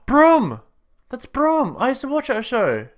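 high, loud delighted cry; excited. Real, not acted. Delighted recognition of a toy.

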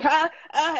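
high broken gasps; choked sobbing gasps. Retold or imitated. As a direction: gasp out high, unable to speak. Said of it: "I was just like".

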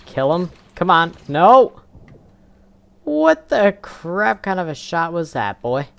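high, loud furious yelling; raging gamer. Real, not acted. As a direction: rage at the teammate's shot. Furious at a bad shot.

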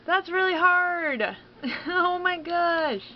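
high, slow whining wail; whiny frustrated cry. Real, not acted. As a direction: whine like it's unfair. Frustrated at hard level.

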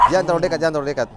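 fast, loud warning; hurried and loud, warning them back; urgent warning. Real, not acted. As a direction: rattle out an alarmed caution. Urgent warning as dogs get close.

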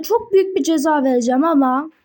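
ordinary delivery

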